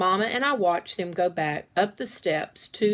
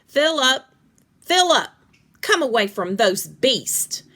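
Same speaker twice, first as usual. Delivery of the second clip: loud, high call across the yard; 6 semitones higher, 7 dB louder than usual. Acted. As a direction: call him back sharply. Woman calling the boy away.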